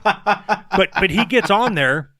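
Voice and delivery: laughing. Real, not acted. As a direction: breaks into laughter mid-sentence. Laughing through his point.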